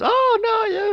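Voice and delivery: high, loud cartoon cry; mock high wail. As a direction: squeal it like a melting villain. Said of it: "he goes".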